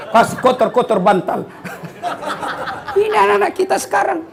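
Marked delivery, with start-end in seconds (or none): 1.5-2.9 soft unvoiced chuckle; breathy laugh. Real, not acted. Preacher laughing softly at his beard joke. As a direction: laugh quietly at your own joke.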